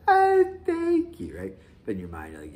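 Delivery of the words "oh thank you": high, slow, sugary mock thank-you; mock grateful thanks. Guests thanking the host for snacks, mock high sweet voice. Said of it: "And they're like".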